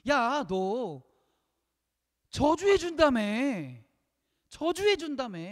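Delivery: high, loud indignant complaint; acted angry protest. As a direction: play an angry king feeling cheated. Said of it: "화가 난 거예요". (화난 척 높은 소리로 따지듯)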